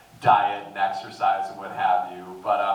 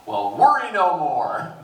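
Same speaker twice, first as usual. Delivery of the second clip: usual speaking voice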